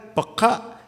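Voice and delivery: ordinary talk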